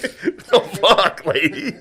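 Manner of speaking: high, laughing protest; mock outraged yelp. Reacting to the rough swab.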